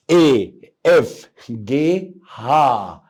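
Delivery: drawn-out very slow letters; slow letter demo. Shown as a demonstration. Teacher demonstrating German letters to students, very slowly. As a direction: spell each letter out slowly for the class. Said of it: "سامعك من جوه".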